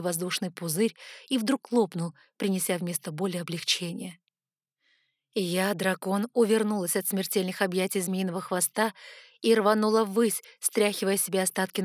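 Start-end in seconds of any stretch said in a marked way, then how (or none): none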